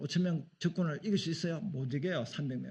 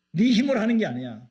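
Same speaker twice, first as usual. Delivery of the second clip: loud, higher firm rebuke; stern God; 5 semitones higher, 7 dB louder than usual. Retold or imitated. God insisting to Moses, stern and loud. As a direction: hammer the point home. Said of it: "화가 났어요".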